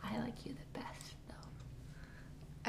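secret bedtime whisper; child whispering a secret to her favourite stuffed dolphin. Retold or imitated. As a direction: confide it in a tiny whisper. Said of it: "and I would whisper".

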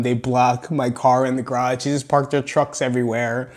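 ordinary talk, nothing marked

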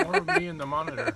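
high, laughing mock whine; whiny musician voice. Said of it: "What's the thing you hear the most?".

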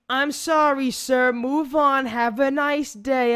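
loud, high, slow fake-polite apology; loud and high, overly sweet sir-talk. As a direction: brush off the angry driver with mock courtesy. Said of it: "this my friend goes".

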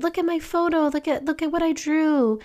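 put-on excited child. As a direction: grab every visitor's attention.

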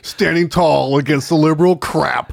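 loud, laughing trailer narration; movie trailer voice. Pretend. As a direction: boom it out like a trailer. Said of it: "In a world".

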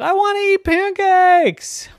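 put-on loud, high kid's yell; excited outburst. A narrator's reading. Hungry Donald to his friends, excited yell. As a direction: blurt it out like a hungry child.

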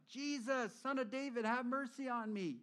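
loud, high cry for mercy; a blind beggar crying out to Jesus; calling out. Acted. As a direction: shout it out to be heard over the crowd. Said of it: "he started calling out".

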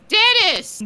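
very high-pitched mock contestant shout; blurted quiz answer. Contestant answering the Jeopardy question, in a high excited blurt.

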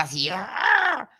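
slow mock angry growl; playful imitation of a seething woman growling. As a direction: let out a drawn-out furious ah. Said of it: "Ella va enojada".

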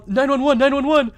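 loud, high fake emergency cry; mock panic. Caller acting out an emergency call, mock panic. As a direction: call out urgently in hammed-up alarm.

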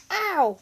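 high, loud ow; pained cry. A narrator's reading. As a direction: yelp as the arm is twisted. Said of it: "cried Bertie".

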